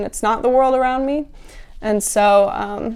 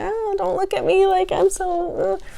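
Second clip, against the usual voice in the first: put-on self-pitying girl voice; 9 semitones higher than usual. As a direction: play the sulky insecure girl. Retold self-conscious moment.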